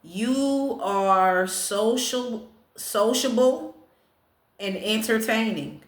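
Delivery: very slow, deliberate reading; ceremonious, stretched-out announcement; fortune proclamation. Real, not acted. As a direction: draw out each word of the fortune. Reads fortune aloud to viewers.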